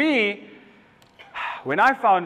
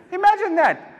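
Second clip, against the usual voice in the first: mock amazement; 10 semitones higher, 3 dB louder than usual. Incredulous punchline.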